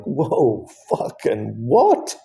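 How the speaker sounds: a slow, drawn-out stunned "what"; stunned disbelief. Retold or imitated. Hearing he needs open heart surgery, stunned and slow. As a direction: let the shock stretch the words. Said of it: "I'm like".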